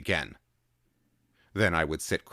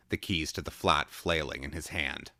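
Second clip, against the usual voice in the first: ordinary delivery, nothing marked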